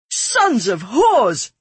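drawn-out, high-pitched startled yell; high, loud outburst of surprise; startled shout. A narrator's reading. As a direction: shout it out in shock, stretching the words. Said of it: "I shouted, startled".

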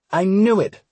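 high, loud triumphant accusation; accusing exclamation. A narrator's reading. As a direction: burst out as if catching someone out. Stella catches him without vest.

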